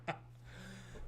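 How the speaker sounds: helpless high-pitched laughing speech. Real, not acted. Admitting the accent is limited, laughing. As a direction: squeeze it out through laughter.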